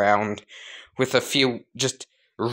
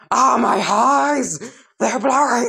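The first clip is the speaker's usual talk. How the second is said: agonized wail; 10 semitones higher, 4 dB louder than usual. Acted. Sludge villain as his eyeballs burst, high panicked wail. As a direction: wail as if your eyes are burning.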